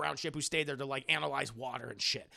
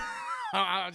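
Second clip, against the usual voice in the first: high burst of laughter; 4 dB louder than usual. Real, not acted.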